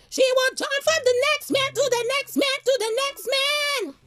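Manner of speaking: high-pitched sneering delivery; high, mocking gossip voice; mocking high singsong. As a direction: taunt her in a high put-on voice.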